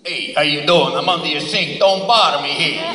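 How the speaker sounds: loud, brash Brooklyn Italian plumber. Retold or imitated. Plumber telling the homeowner off, loud Brooklyn accent. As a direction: bark it like a Brooklyn plumber under the sink. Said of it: "Italian plumber from Brooklyn, obviously".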